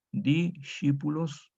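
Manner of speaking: "Discípulos" drawn out slowly, sc as sh; slow sh Latin. Shown as a demonstration. Teacher slowly models "Discípulos" with sh. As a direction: stretch out "Discípulos", dishípulos. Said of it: "parecida a la sh del italiano".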